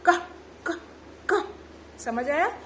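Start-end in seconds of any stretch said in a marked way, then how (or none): 0.1-1.5 short throaty क, pauses between; from the throat. Shown as a demonstration. Teacher sounding C for cat, short क from the throat. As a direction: pop each क from the throat. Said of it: "कंठ से".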